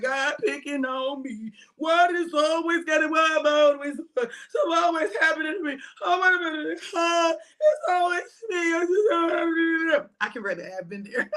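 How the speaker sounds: high, whiny mock crying complaint; mock self-pity. Mocking sad listeners who complain to God, whiny. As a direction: whimper it like someone feeling picked on. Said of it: "Y'all be like".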